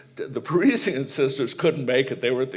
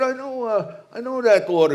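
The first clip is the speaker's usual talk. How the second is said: higher, louder, hearty bluff guy; eager jock voice; 11 semitones higher, 6 dB louder than usual. Retold or imitated. Coach telling the nun he knows her order, hearty. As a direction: play the big friendly coach, pitched high.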